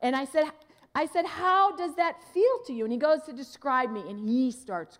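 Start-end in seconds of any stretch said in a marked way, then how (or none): 1.2-2.8 higher, louder eager asking; excited, warm curiosity; eager question. Retold or imitated. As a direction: press him keenly how it feels.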